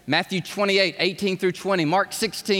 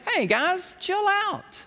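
angel voice; 10 semitones higher, slower than usual. Retold or imitated. Angels calming the disciples.

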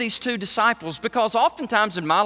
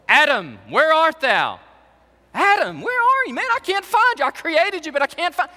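higher, louder, playfully calling for Adam; mock searching God; 8 semitones higher, 4 dB louder than usual. Preacher voicing God looking for Adam, playful call. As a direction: call out like a parent hunting a hiding child.

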